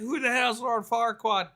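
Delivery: a touch of an irritated ogre; Shrek voice. Retold or imitated. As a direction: ask it with put-on annoyance. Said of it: "He's like".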